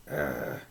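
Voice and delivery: low soft growl; bear growl. A narrator's reading. Bear answering the grandmother's greeting with a low growl. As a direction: rumble it like an old bear. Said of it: "growled Old Bear".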